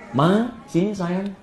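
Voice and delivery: slow, tender husband's call; sweet affectionate call. Acted. Husband calling his wife after the salty noodles, sweetly. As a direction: coax her over gently and sweetly. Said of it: "kalimat yang manis untuk memanggil istrinya".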